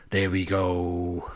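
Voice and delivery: slow, resigned there we go; slowly drawn-out, resigned. Real, not acted. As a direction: stretch it out with a sigh.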